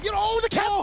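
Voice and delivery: excited shout. Real, not acted. Caster hype on big play.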